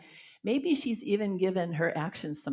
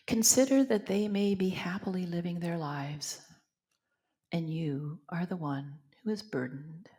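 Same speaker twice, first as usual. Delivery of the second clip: guided meditation. Acted. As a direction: guide them gently into reflection. Leads silent contemplation.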